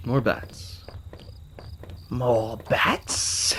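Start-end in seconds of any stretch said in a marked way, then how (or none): 2.1-3.6 maniacal villain voice with a high cackle; Joker. Acted. Player spotting more bats, as a cackling Joker impression. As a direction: say it menacingly, then burst into a wild high laugh. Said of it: "That's my Joker impression".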